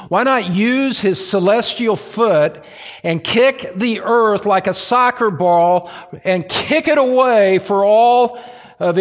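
high, loud rhetorical challenge; forceful preaching. Acted. Preacher asks why God spares a rebellious earth, forceful preaching. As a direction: hurl the question out over the congregation.

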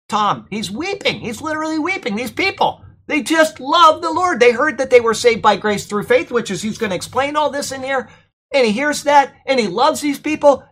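high-pitched, pained retelling; acted anguish. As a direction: plead as if heartbroken for these people. Acts out the pastor's grief.

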